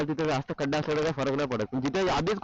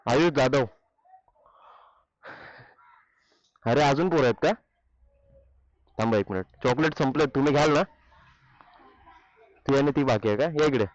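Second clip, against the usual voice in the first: loud calling, peaking on the last call; 9 dB louder than usual. Real, not acted. Calling kids to hand chocolates.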